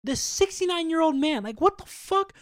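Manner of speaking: outraged disbelief. Real, not acted. Outraged at the woman hitting an old man, high-pitched. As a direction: voice shoots up in disbelief.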